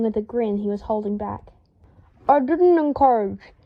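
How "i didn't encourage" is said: words round a toothbrush; garbled through toothbrush. A narrator's reading. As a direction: talk through a mouthful of toothbrush. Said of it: "he garbled".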